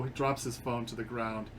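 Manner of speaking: ordinary talk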